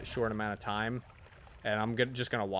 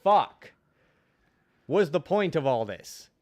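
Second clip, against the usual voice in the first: exasperated rant; 6 semitones higher, 6 dB louder than usual. Retold or imitated. Reader ranting about the pointless book, exasperated. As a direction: vent the frustration. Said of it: "And I was like".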